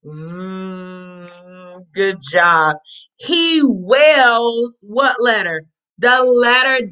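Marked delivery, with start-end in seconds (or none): 0.1-1.8 "Mm" as a soft, held vvv; V sound. Shown as a demonstration. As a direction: buzz a long, low V for "Mm". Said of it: "what's the V sound".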